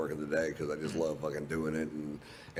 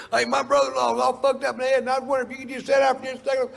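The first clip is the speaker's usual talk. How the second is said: high, loud, frantic fan yelling after him; a pushy fan's high, loud shout; 8 dB louder than usual. Retold or imitated. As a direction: screech at him like a desperate stranger. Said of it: "somebody going".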